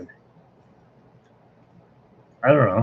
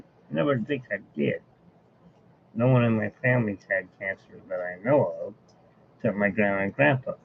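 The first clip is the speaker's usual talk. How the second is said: ordinary delivery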